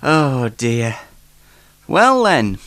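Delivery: normal talking voice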